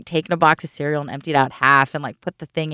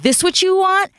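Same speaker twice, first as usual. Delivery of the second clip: much higher, sarcastic pitch; 13 semitones higher than usual. Retold or imitated. To the friend while pulling out the bag, sarcastic. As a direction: hand it over with a scornful edge.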